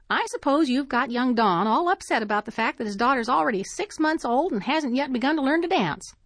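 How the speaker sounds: a hint of a fussy elderly neighbour poking fun; prim old lady.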